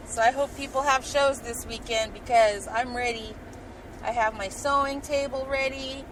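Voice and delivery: usual speaking voice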